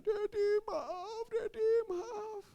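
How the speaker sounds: high, slow drawn-out wail; mock pitiful kid's crying; imitated crying child. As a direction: sob an apology to father. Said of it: "saya liatin dia yang nangis".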